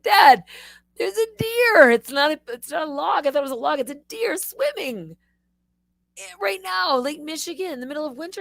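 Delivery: high, frantic cry to Dad; hammed-up panic at an unbelievable sight; panicked alarm. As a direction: babble in high-pitched disbelief.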